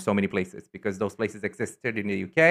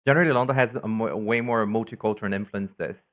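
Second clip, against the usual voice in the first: usual speaking voice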